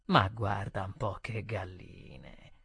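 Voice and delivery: low, soft and drawn out; muttered between teeth. A narrator's reading. Waiter bending behind a customer, muttering between his teeth. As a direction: mutter it slowly through your teeth. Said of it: "mormorare tra i denti".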